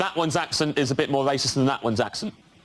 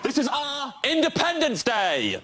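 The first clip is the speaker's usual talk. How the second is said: a rousing Scottish rallying cry, higher and slow; a Scottish hero roaring to the troops; Scottish accent; 12 semitones higher, slower than usual. Acted. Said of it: "imagine this in a Scottish accent".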